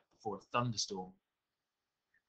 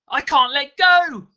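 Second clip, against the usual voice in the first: high, drawn-out agonised scream; 14 dB louder, slower than usual. A narrator's reading. Mugger unable to drop the red-hot knife, screaming. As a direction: scream it out at full force. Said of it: "screamed the mugger".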